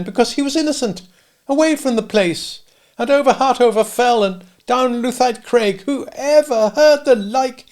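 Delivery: put-on high kindly old dame voice; a cottage dame fussing over a boy. Old dame offers the sooty boy milk, high and kindly.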